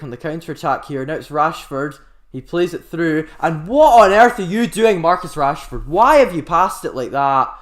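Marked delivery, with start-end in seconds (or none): 3.4-7.6 loud, high, incredulous shout at the player; exasperated outburst. Real, not acted. Commentator yelling at Rashford over a dreadful pass, exasperated shout. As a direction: yell in disbelief at a wasted chance.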